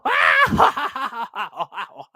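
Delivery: loud, falling peal of laughter; loud cackle. Acted. Laughing after refusing the wizard, a loud falling cackle. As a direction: burst into a cackle that tumbles downward.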